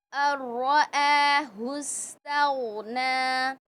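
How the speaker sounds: slow, melodic tajweed recitation; Quran recitation. Shown as a demonstration. Teacher reciting Surah Alaq for students, slow melodic recitation. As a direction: chant each verse slowly and clearly.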